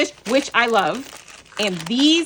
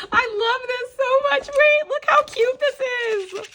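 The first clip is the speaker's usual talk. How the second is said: much higher and louder, slowed with emotion; overjoyed; 5 dB louder than usual. Real, not acted. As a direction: gush like you're about to happy-cry. Overjoyed by gift, nearly tearful.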